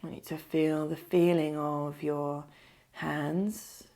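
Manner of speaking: unhurried meditation voice, leaving space to breathe; guided meditation. Acted. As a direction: lead them gently into stillness, phrase by phrase.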